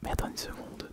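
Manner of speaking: close-mic ASMR whisper; right in your ear. Real, not acted. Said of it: "la vidéo A S M R".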